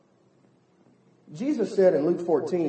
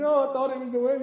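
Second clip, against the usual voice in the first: higher, lightly voiced heartbroken girl; a hint of a weepy daughter; mock tearful daughter; 7 semitones higher, faster than usual. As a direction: imitate a teen whimpering to her dad, hammed-up. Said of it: "she got tears streaming down".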